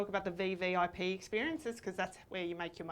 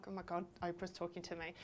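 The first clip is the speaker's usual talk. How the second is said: hushed, very soft aside; awestruck inner thought; 2 semitones lower, 13 dB quieter than usual. Retold or imitated. Fan realising Oprah is addressing her, hushed awe. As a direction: think it aloud under your breath. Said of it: "And I'm like".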